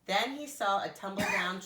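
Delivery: ordinary delivery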